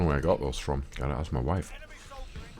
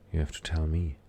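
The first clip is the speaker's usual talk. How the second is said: usual speaking voice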